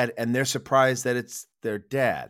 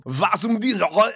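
slow, higher fake foreign gibberish; mock gibberish Russian; 6 semitones higher, 3 dB louder, much slower than usual. Mocking dumb Americans with fake Russian gibberish. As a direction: spout fake Russian, drawn out. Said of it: "you could literally do".

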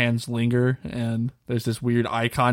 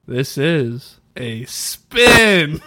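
dramatic reveal; 3 semitones higher, 6 dB louder, much slower than usual. Acted.

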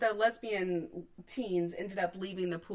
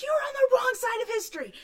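loud high yell cracking into laughter; laughing shout; 14 semitones higher, 7 dB louder than usual. Retold or imitated. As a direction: tell him off, half laughing. Retold yelling at man.

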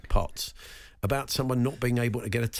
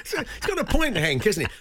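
high laughing burst at the joke; 12 semitones higher than usual. Real, not acted. Host laughing at a sad restaurant story. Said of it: "I don't know why I'm laughing".

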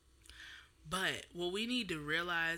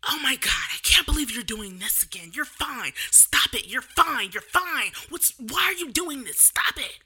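loud, high exasperated yell; imitated yelling mother; 7 semitones higher, 10 dB louder than usual. Mother to her crying child who is fine, imitated angry yelling. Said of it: "you're yelling at a child".

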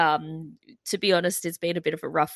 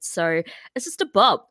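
ordinary delivery

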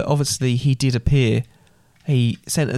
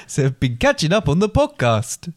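put-on Belgian accent; 3 semitones higher than usual. Reading Al's podcast message to Dad, attempted Belgian accent. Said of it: "try and read it in his accent".

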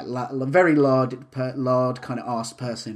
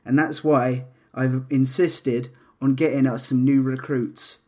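ordinary talk, nothing marked

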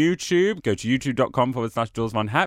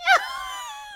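squeaky laugh; 3 dB quieter than usual. Real, not acted. Laughing in helium voice.

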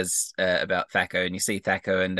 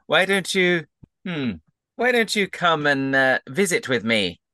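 higher-pitched, hesitant invitation in character; inviting game character; 6 semitones higher than usual. Acted. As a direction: offer it haltingly to a new friend. Game character shyly offers invitation.

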